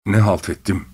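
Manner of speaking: usual speaking voice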